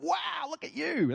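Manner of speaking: high, gushing exclamation; amazed delight. Acted. Acting out greeting someone with awe.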